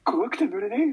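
high, loud panicked whine; whiny scared Shinji. Pretend. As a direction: wail it like a scared boy. Mock Shinji line from meme.

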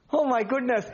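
ordinary talk

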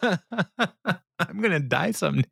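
slow, high, laughing through it; laughing, mock profound. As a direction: savour the line like a grand revelation, giggling. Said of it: "existential Tom Haverford".

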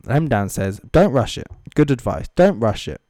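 ordinary delivery

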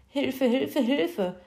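high-pitched creature crying for help; scared little creature voice; frightened muttering. A narrator's reading. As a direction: plead for help from hiding, in a put-on high voice. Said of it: "aus dem Schrank murmeln".

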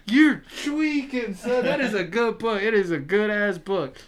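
high, loud teasing scold; playful scolding. Real, not acted. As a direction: tease your friend for missing it. Mock scolding friend.

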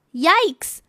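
put-on high, surprised child's cry; startled yikes. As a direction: jump at the sight of the mess. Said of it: "said Shelley".

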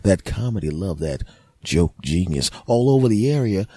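ordinary talk, nothing marked

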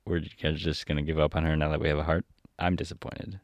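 plain reading voice

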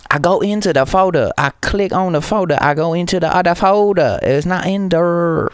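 thick mock Chinese accent, pitched high. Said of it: "Chinese accent".

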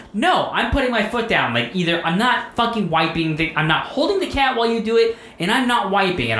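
high-pitched retold protest; adamant refusal. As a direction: put your foot down, replaying the argument. Acting out his refusal to wife.

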